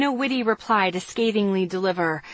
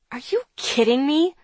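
sharp, higher indignant outburst; angry; 6 semitones higher than usual. A narrator's reading. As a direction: fire it back, outraged. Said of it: "jab a finger at him angrily".

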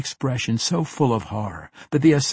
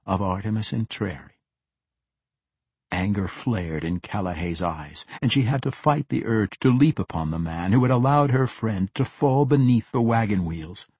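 usual speaking voice

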